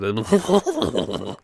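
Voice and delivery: high wordless whooping babble; imitated cartoon gibberish; random babbling. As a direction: burble nonsense in a high voice. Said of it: "just a big random babbling".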